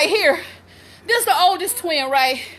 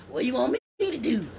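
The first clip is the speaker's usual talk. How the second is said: low, quick, softer mimicry; imitating unbothered daughter; 6 semitones lower, 8 dB quieter, faster than usual. Mother quoting her oldest to viewers, imitating her unbothered. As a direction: mimic a useless daughter, pitched down and hurried. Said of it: "She just walking around talking about".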